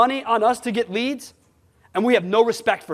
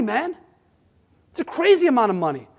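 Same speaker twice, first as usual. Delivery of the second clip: ordinary delivery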